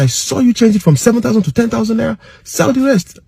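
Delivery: high, angry confronting voice; upset accusation. Retold or imitated. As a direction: call him out on the changed numbers. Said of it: "I was so upset".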